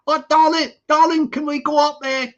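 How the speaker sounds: high, loud puppet begging; pleading puppet voice. Acted. Puppet pleads for more money.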